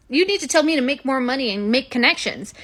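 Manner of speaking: normal talking voice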